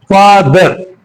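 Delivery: Father broken into two beats, fa, ther; father with a gap between its two parts; split into syllables. Shown as a demonstration. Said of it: "pronounced in two attempts".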